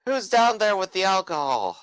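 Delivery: put-on drunk calling down. Drunk shouting down from window.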